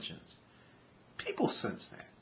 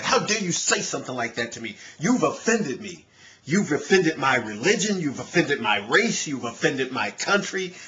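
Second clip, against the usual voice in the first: louder, hammed-up indignant outrage; over-the-top mock outrage at a slight; mock offended rant; 4 semitones higher, 5 dB louder than usual. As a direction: play someone deeply offended by everything. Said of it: "They get offended by everything".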